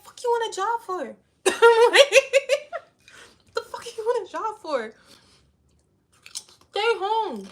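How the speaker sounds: high, loud mock-mom voice snapping back; mom scolding. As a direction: play the brash mom scolding her daughter.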